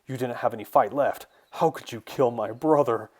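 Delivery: loud, outraged villain's protest; angry cry. A narrator's reading. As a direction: cry out accusingly, building in force. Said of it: "Kualachi cried".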